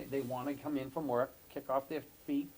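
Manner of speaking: ordinary talk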